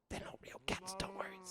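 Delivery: hushed reassuring whisper; very soft, voiceless reassurance; whispered aside. Real, not acted. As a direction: whisper a secret aside to the audience.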